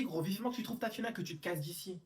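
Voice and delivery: ordinary talk, nothing marked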